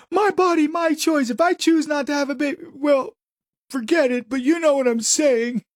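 high mincing mock activist voice. Host mocks a pro-choice activist in a high mock voice.

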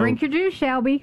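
high, fussing stage-mother line; a lightly voiced mother nagging her daughter to drink; Steel Magnolias mom line. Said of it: "the role that I've done the most is Malin in Steel Magnolias".

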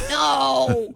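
sharp playful shout; mock shout. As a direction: bark a joking no. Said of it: "I'm like".

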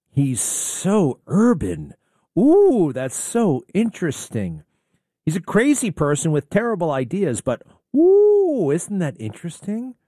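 hammed-up gushing fan voice. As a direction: swoon over him like an impressed liberal.

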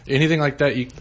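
ordinary talk

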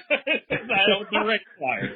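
slow, laughing admission. Real, not acted. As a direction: say it through real laughter. Laughing at own remark.

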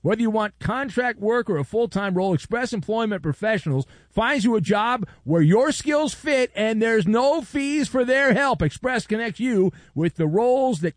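radio ad read. Acted. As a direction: sell it like a radio host's promo. Host reads a sponsor ad.

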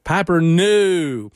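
put-on slow drawn-out Durham accent; Parker Posey impression. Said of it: "impersonate Parker Posey going".

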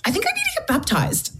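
high wondering realisation. Retold or imitated. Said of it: "woke up and went".

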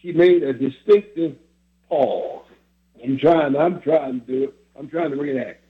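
ordinary talk

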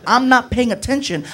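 ordinary delivery

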